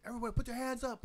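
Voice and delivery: high, loud retold police order; mock cop shout; cop command. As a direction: yell it like a cop with guns drawn. Cops ordering hands up.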